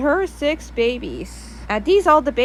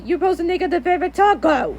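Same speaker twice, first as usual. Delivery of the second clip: fast, higher scolding; angry scolding; 5 semitones higher, faster than usual. Acted. Miguel scolding dad about the taco, fast and angry. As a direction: rattle off the complaint, annoyed. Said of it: "angry about him and shouting".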